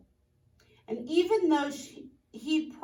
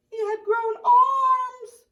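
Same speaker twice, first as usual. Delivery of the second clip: much higher, louder, slow crying wail; mock wail; 13 semitones higher, 11 dB louder, slower than usual. A narrator's reading. Reading to children, hammed-up crying wail. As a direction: wail the reveal like a tragedy. Dramatic story twist.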